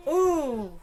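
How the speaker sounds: high laughing ooh. Real, not acted. Reaction laugh.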